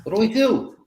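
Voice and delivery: high, loud, alarmed plea; panicked cry. Acted. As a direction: call to your companion in a panic. Player voices panicked character seeing goblin.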